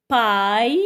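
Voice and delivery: PAILLE held long, rising at the end; syllable drill. Shown as a demonstration. Teacher leads children reading syllables, drawn out. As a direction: stretch PAILLE into a lifted "pa-y". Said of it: "Lisons avec moi".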